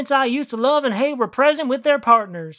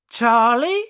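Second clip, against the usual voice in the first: ordinary delivery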